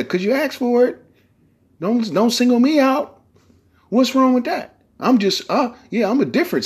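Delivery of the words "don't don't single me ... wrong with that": usual speaking voice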